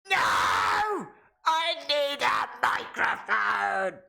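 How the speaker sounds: slow, high, wailing shout; mock wailing wrestler. Blinded wrestler begging for the mic, a slow mock wail.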